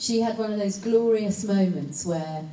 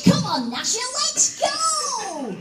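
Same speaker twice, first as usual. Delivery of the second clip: high, loud shouting cartoon boy; cartoon kid bellowing at his dog, much higher and louder; Dennis the Menace voice; 6 dB louder, slower than usual. Shown as a demonstration. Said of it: "he talked like this".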